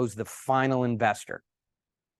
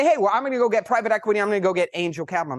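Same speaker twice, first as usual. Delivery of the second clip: quick, raised-pitch mock eagerness; eager go-getter; 6 semitones higher, 3 dB louder, faster than usual. Founder announcing plans to go get capital, eager and rushed. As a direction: rattle it off fast and higher.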